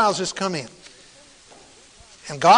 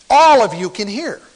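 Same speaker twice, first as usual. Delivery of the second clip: louder, carrying to the whole room; projected to everyone; 5 dB louder than usual. Shown as a demonstration. Preacher assuring the whole congregation they can hear, projected. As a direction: raise the volume so everyone hears. Contrast to quiet demo, addressing everyone.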